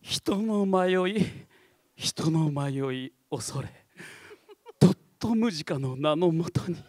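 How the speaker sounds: a touch of a slow mystical villain; lightly voiced eerie incantation; character voice. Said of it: "そっくりだね".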